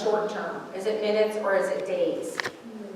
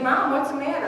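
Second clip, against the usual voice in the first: innocent son; 6 semitones higher, 4 dB louder than usual. Retold or imitated. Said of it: "He's like".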